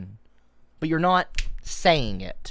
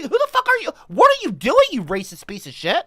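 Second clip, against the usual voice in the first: mock outburst; 8 semitones higher, 5 dB louder than usual. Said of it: "I'm like".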